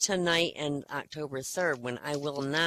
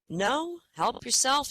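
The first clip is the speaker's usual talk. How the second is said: lightly voiced woman, higher pitch; woman's reply; 7 semitones higher, slower than usual. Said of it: "she says".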